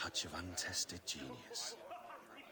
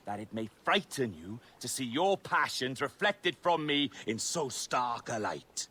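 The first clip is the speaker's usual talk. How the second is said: rousing speech; 6 semitones higher, 6 dB louder than usual. Acted. Captain addresses his crew.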